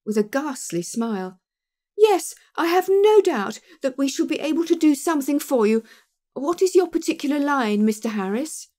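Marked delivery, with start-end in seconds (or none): 2.0-8.7 forced cheer. A narrator's reading. Pinner's forced cheer continues.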